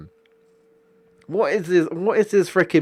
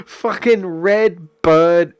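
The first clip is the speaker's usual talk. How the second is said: angry outburst; 4 semitones higher, 6 dB louder, slower than usual. Real, not acted. Cursing the angry customer.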